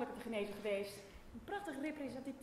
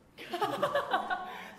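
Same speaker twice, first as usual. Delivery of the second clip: loud, high mocking laugh; a burst of scornful laughter; 9 semitones higher, 9 dB louder than usual. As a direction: laugh out loud in his face. Loud laugh mid-quarrel.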